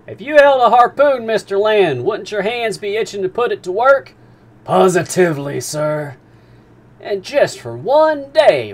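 a captain teasing the harpooner's itch; Captain Nemo. Acted. Captain Nemo tempting Ned.

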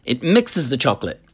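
high, loud and bubbling; excited factory owner explaining; eager chocolatier. Acted. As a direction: pound out the verbs with relish. Wonka explains waterfall.

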